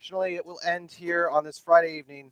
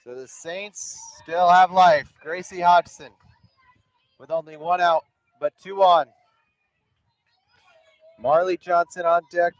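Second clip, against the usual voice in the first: play-by-play; 3 semitones higher, 6 dB louder than usual. Acted. Live softball play-by-play.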